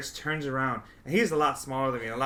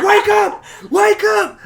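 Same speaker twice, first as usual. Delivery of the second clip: much higher and louder panicked yell; screamed wake up; 15 dB louder, slower than usual. Retold or imitated. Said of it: "I literally screamed".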